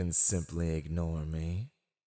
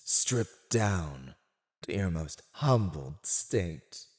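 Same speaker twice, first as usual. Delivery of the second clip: normal talking voice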